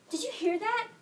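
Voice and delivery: sudden high-pitched alarmed question; acted mother startled by a noise. Mother asks daughter if she heard that, alarmed.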